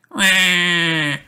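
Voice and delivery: put-on high toddler wail; a little piglet bursting into tears; crying wail. A narrator's reading. George crying over his broken dinosaur, high wail. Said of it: "cries George".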